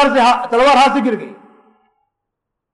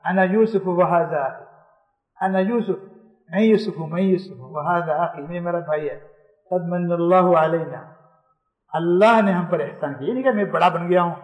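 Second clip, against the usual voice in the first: gentle humble reveal; 6 semitones lower, 7 dB quieter than usual. Acted. Yusuf gently reveals himself to brothers.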